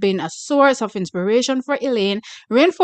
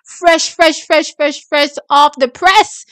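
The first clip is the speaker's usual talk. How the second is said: loud hyped-up chant, pitched up; excited; 4 semitones higher, 6 dB louder, slower than usual. Real, not acted. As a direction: hype it like breaking news. Hyping fresh news.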